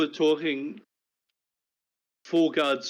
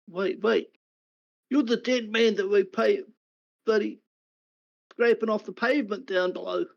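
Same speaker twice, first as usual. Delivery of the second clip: higher, louder guard doing a double take; suddenly recognize the dead man; guard realizing; 8 semitones higher, 3 dB louder than usual. Acted. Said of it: "He looks at you and goes".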